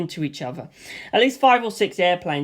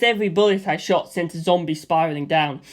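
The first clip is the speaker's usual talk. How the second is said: plain reading voice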